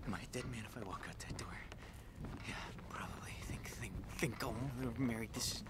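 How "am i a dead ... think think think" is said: fast, breathless whisper to himself; hushed and frantic, as if someone might hear; panicked whisper. Real, not acted. Gamer fearing for his life, whispering in panic. Panicking after the villain's threat.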